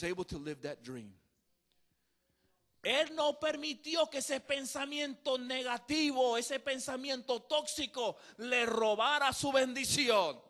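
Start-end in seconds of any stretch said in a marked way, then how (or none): 2.8-10.4 higher, much louder, ending in a slow shout; full-force preaching. Acted. Preacher warns the church about toxic thoughts, shouting. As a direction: thunder it from the pulpit.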